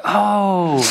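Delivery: drawn-out, high "oh" of dawning understanding; mock realisation. Teasing the congregation about his Bible school past, mock realisation. Voicing listeners' sudden realisation.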